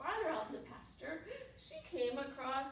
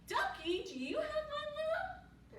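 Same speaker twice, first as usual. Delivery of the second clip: cow asking. A narrator's reading. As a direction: call out sweetly high, stretching the words. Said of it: "she asked the ducky".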